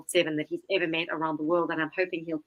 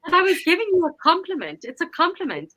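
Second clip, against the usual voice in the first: shaky protest; 11 semitones higher, 4 dB louder than usual. Retold or imitated. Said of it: "like, shaking".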